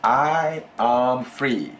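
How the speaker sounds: slow, deliberate prompt for the student; slow teacher prompt. Shown as a demonstration. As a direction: spell out the phrase slowly for the learner. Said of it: "would you please say".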